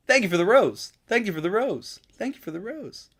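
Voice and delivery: high, looping sing-song thanks; TikTok NPC streamer. Acted. Thanking viewers for a gift, high mock NPC voice. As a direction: mimic a chirpy livestream NPC in a high voice.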